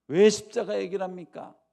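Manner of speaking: angry protest. Retold or imitated. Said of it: "화를 내면서".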